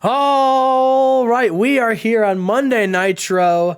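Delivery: drawn-out announcer intro; show opener. Pretend. Host kicking off Monday Nitro, announcer style. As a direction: open the show with a big stretched-out welcome.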